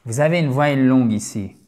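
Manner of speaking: ordinary talk, nothing marked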